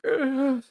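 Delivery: a hammed-up pretend sob; mock sob. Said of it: "imagine me telling you a fake story".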